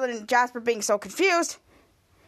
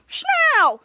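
high, loud snapped command; mock yell; 12 semitones higher, 7 dB louder than usual. Teacher shouting the kids to hurry, mock yell.

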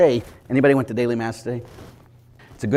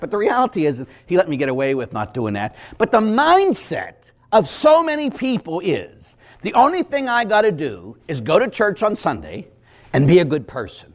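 normal talking voice

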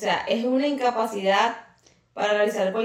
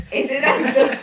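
giggling through the words; laughing, louder, can't keep it together; 3 semitones higher, 7 dB louder than usual. Real, not acted. Restarting the explanation to the listener, laughing.

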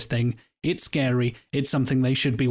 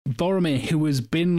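normal talking voice

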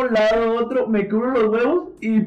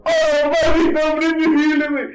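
mock dramatic cry; 8 semitones higher, 3 dB louder than usual. Friend fighting invisible man.

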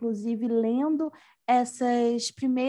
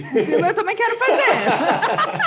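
laughing; 9 semitones higher, 7 dB louder than usual. Real, not acted. Laughing at the slip.

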